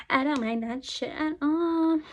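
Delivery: mock-bold declaration; put-on voice. Shown as a demonstration. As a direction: belt it out in a cartoon voice.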